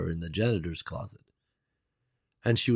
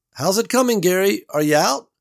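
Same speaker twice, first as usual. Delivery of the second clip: higher, louder call up to the vent; 11 semitones higher, 7 dB louder than usual. A narrator's reading. Beverly calls up to Gary in the vent, loud. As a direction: call out to someone out of sight. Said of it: "Beverly called out".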